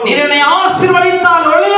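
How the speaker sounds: high, ringing pulpit reading; declaimed scripture. Real, not acted. Preacher repeats the verse after the reader, high and declamatory.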